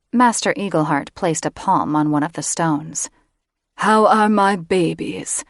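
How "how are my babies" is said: cooing over the eggs, slow; a creepy doting father voice; sinister fondness for his babies; doting mage. A narrator's reading.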